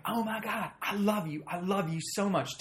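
high, hammed-up adoring fan; imitated gushing fan. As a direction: gush like you're imitating a starstruck woman. Woman gushes at supposed celebrity.

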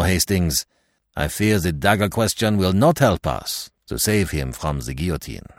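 put-on measured detective with a French accent; accented Poirot. Poirot as a figure of the story.

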